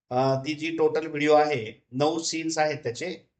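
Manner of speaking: usual speaking voice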